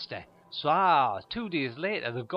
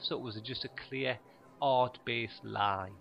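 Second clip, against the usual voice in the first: normal talking voice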